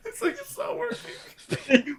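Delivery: high, slow laughing voice; laughing squeal. Real, not acted.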